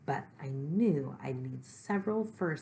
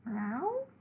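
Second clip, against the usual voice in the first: high, questioning meow; cat's meow; 10 semitones higher than usual. Acted. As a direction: answer like a curious cat, pitched up. Cat answers narrator's question.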